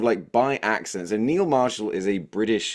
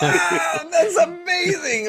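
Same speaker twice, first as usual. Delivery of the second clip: high, slow, hammed-up amazement; mock amazed shout; 4 dB louder, much slower than usual. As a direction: squeal it like you're overwhelmed. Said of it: "I was like".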